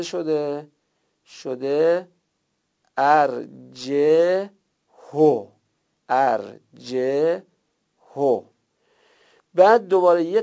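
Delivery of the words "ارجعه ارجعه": ارجعه said very slowly and carefully, each sound held; teacher slowly models ارجعه for students to copy; slow model recitation. Shown as a demonstration. Reading out the word for students, slowly.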